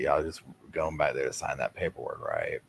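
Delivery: soft, low confiding imitation; mock chummy aside. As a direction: drop to a quiet, knowing undertone. Mimicking a man chummy with jurors.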